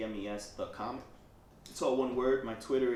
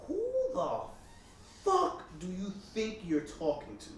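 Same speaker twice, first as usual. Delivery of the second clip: retold angry retort; 7 semitones higher than usual. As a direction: imitate indignant disbelief with heavy pauses. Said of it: "I got tight".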